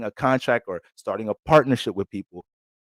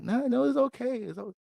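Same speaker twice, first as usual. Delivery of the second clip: higher, falsely calm brush-off; mock reassurance; 14 semitones higher, 5 dB quieter than usual. Partner fakes calm to avoid a fight, higher mock reassurance. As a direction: pretend it's fine.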